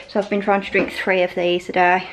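usual speaking voice

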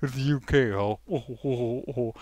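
lightly hammed-up spluttering fop; hint of a bumbling leading man; mock Hugh Grant stammer. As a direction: stammer out the question like a flustered Englishman. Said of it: "Hugh Grant says".

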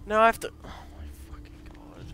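raging gamer. Real, not acted. Gamer rages under breath.

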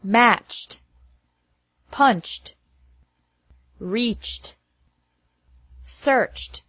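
"matched" said alone, ending in CH-T. Shown as a demonstration. Teacher drilling verbs ending in CH, -ed pronounced as T. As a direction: say "reached" as one syllable with a final T. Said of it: "after verbs ending with a C H".

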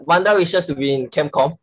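ordinary delivery

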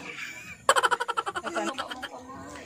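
long high-pitched laughing fit. Real, not acted. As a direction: keep laughing, high and soft. Laughing about lucky salad.

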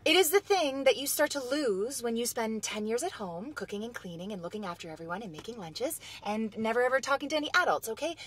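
usual speaking voice

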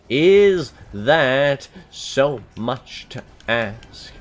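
mock pleading, slowed right down; drawn-out plea. Shown as a demonstration. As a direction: drag out every word of Is that so much to ask.

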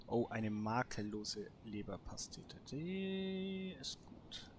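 ordinary talk, nothing marked

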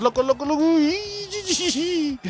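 climbing to a high, soft squeal; nervous squeal. Real, not acted. Commentator watching the English attack, nervous rising squeal. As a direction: let the repeated word rise into a thin squeak. Tense moment as rivals attack.

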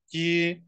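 知 on a flat high pitch; 知 said high and level, not falling. Shown as a demonstration. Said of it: "flat high pitch".

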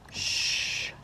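normal talking voice

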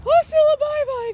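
high, loud cheery sign-off. Real, not acted. Signing off to her viewers, bubbly. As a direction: sing out the goodbye.

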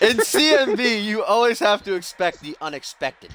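put-on commit to the Russian accent this time; Russian accent retry. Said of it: "should I do that Russian accent?".

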